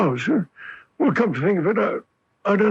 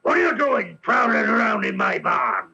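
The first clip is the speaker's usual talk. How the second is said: angry innkeeper; 4 semitones higher than usual. Acted. Innkeeper catching spies in his barn, angry shout. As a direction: bark at the intruders.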